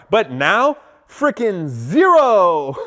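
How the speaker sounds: slow, drawn-out "zero"; emphatic verdict. Retold or imitated. Customer telling salesman his chances now, drawn-out mocking emphasis. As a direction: stretch "zero" out for a mocking punchline. Punchline of imagined scolding.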